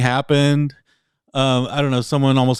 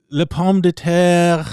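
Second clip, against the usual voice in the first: slow, higher, hammed-up French; imitated French; 6 semitones higher, 5 dB louder, slower than usual. Man imitating his girlfriend's French sleep-talk, slow French accent. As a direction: draw it out in a put-on French accent. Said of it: "she started mumbling in French".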